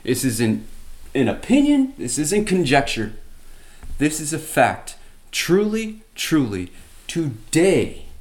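high, loud solemn proclamation from the cross; a dying Jesus crying out his promise. Acted. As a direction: declare it as eternal truth, voice lifted high. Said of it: "He says".

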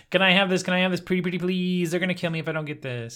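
high-pitched, hammed-up begging; mock pleading. Columbus begs the natives for the disc, mock pleading. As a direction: plead like a wheedling kid, playfully exaggerated.

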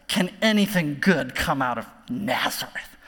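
low, dismissive quote with disdain; disdainful. Retold or imitated. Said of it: "hear the disdain in his voice".

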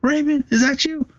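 can't believe it's him; retold disbelief, voice jumping up high; shocked recognition. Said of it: "he was like".

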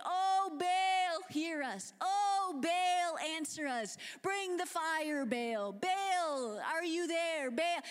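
high, loud pleading cries to a god; frantic calling out. Acted. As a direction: beg Baal loudly, over and over. Baal prophets crying out to Baal.

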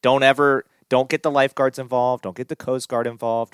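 ranting command. Real, not acted. Ranting orders at listeners.

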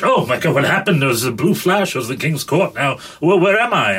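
hint of a flustered old Englishman. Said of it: "an old Englishman comes through a blue flash".

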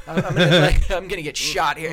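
loud words broken by laughter; laughing. Real, not acted. Comic recalling a tough room, laughing as he talks. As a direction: chuckle through the retold thought. Laughs retelling fear on stage.

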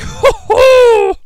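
high falling cackle; lecherous cackle. Retold or imitated. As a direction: cackle like a drunk old lecher. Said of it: "grin on his face like".

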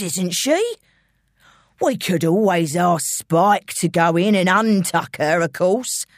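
threatening London gangster; London villain, losing patience. Acted. Intruder threatening George and his family, menacing. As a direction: menace under mock-friendly coaxing.

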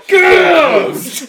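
shout. Real, not acted. Teasing shout.

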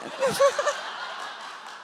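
high breathless burst of laughter; real laugh. Real, not acted. Laughing at the punchline.

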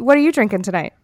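usual speaking voice

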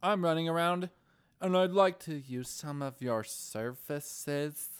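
hammed-up, ending in a drawn-out request; mock game-character voice. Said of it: "he doesn't normally sound like that".

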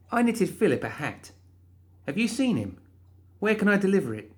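put-on high, bright young woman's voice; excited high-pitched heroine; Molly's voice. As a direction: voice her high and eager. Molly asks sweetly.

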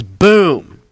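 loud, high "boom" that drops; imitated door slam. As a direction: punch out the slam as one big boom. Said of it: "the door slams".

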